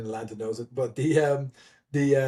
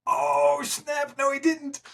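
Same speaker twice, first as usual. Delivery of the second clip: high, hyped "oh snap"; mock the friend who just got called out; teasing crowd cheer; 12 semitones higher, slower than usual. Onlookers cheer the towel callout.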